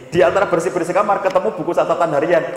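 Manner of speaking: ordinary talk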